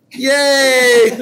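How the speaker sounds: high, loud laughing yay; a real delighted whoop that dissolves into a laugh; laughing cheer. Real, not acted. Laughing cheer after point.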